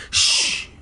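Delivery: hushed shush in the ear; whispered shush. A narrator's reading. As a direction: hush someone sharply. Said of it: "someone whispered in my ear".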